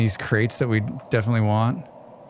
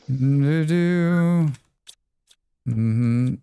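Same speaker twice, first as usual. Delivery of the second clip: ordinary delivery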